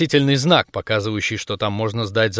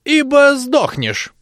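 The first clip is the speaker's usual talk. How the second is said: slow, high crone warning; blunt old woman; 12 semitones higher, much slower than usual. Acted. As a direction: spit it out like a grim granny. (грубое старческое предупреждение)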